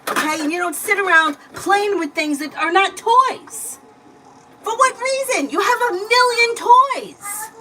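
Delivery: lecturing scold. Real, not acted. Mom lecturing child after scolding.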